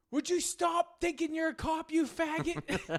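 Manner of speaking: high mock yell of a furious driver; mimicking a Revere driver's high, raging scream; angry old guy. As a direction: imitate the Jeep driver screaming. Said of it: "He's like".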